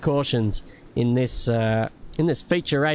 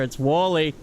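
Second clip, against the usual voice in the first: ordinary talk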